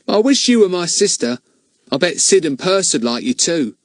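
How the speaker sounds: eager, fond lad; Ern the boy. Acted. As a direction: Ern tells Betts he wishes she were his sister. Ern's voice in the story.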